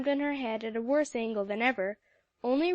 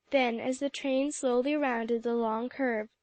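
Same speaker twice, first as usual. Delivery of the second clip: usual speaking voice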